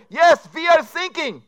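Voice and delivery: put-on high, loud, slow German accent, sinking for thinking; German sinking crew. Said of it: "an S O S message comes over".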